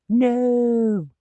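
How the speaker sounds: a put-on shrill drawn-out no; high drawn-out no. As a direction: wail it in cartoon terror. Character about to be clonked.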